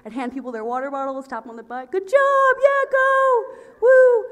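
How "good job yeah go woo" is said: high, loud cheering; hammed-up sideline cheer; mock cheerleader. Cheering teammates on from the bench, high and loud.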